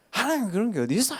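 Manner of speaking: sharp, high, angry retort; angry old man. Retold or imitated. Old shopkeeper scolding the evangelist, high and angry. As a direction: snap back like an offended old man. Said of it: "노인 할아버지 갑자기 화를 내시는 거야".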